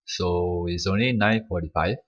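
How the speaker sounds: usual speaking voice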